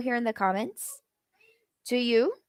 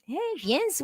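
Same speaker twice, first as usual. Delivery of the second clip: Pittsburgh greeting; 6 semitones higher, 6 dB quieter than usual. Retold or imitated. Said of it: "they come up with yins".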